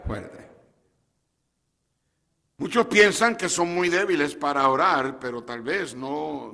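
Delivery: normal talking voice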